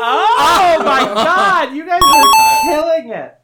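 high, loud laughing wail; loud outburst. Real, not acted. Overcome by friends' grim jokes, high loud wail.

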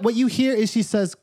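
ordinary delivery

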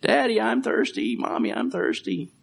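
put-on high child voice, fading to a whisper; whiny child. Preacher imitating a thirsty child, high and whiny. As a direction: play the thirsty little kid. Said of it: "a child saying".

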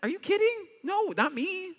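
very high squeaky disbelief; incredulous refusal. Retold or imitated. Pastor answering God's call, squeaky disbelieving refusal. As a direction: squeal it like it can't possibly be you. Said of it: "I'd be like".

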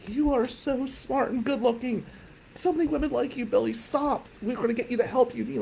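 high, soft, tearful mom imitation; crying mom. Crying mom tells son he'll get help, mock tearful. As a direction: plead like a weepy mother, pitched high. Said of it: "my mom's crying".

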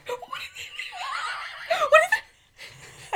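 high, slow, laughing squeal of excitement; high laughing shriek over a cat; excited squeal. Retold or imitated. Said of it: "I was like".

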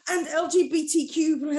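high, laughing Karen parody; fake Karen accent. Mocking a coach tacking on inclusivity, fake Karen accent. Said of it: "stop doing my fake Karen accent".

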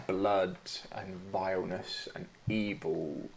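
usual speaking voice

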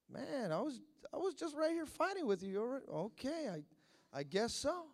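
soft, deflated mumble; mock dejection. Old prophet told he is being replaced, quietly resigned. Samuel voiced as cast aside.

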